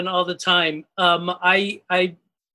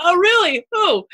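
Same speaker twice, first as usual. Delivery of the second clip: playful cartoon voice; 9 semitones higher, 4 dB louder than usual. Teasing kids who recognized voice.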